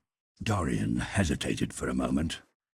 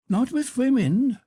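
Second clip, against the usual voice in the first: arch, teasing noblewoman, pitched up; high Duchess voice; 9 dB louder than usual. Acted. Duchess challenging Lord Henry, high and teasing. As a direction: play the witty Duchess, high and bright.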